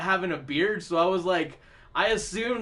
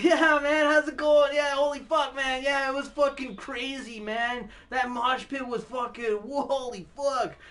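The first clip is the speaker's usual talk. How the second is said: bar rocker voice; 6 semitones higher than usual. Retold or imitated. Chatting with his group at the bar, a higher excited dude voice. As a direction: hype it up like a bro at a metal show. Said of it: "What the fuck was that?".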